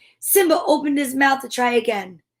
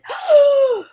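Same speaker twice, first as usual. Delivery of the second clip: sharp high gasp of shock; big gasp; 4 dB louder than usual. Acted. Storyteller gasps in fright as the hyenas show up. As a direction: gasp as if the hyenas just appeared.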